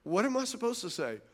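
soft, withdrawn retort; shutting down. Acted. Husband accused of raising his voice, shutting down softly. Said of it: "now you're just gonna shut down".

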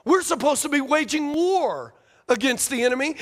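usual speaking voice

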